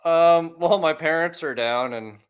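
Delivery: ordinary delivery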